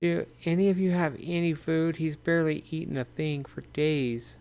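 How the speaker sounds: high, soft motherly voice; a mom soothing her kid while asking strangers for food; gentle mother. Retold or imitated. Mother asks survivors for food for her son, high and soft. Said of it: "she's like".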